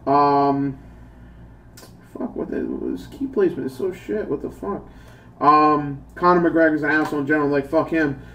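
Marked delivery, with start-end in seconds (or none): 2.1-4.9 annoyed mutter. Real, not acted. Annoyed aside about keyboard.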